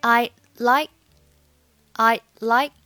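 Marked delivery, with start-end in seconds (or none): 0.0-0.9 "I like" with the k held back, no release; unreleased final consonants. Shown as a demonstration. Teacher showing learners silent endings, "like" with unreleased k. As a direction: cut off "like" before its k pops. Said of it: "末尾也是不用发音的".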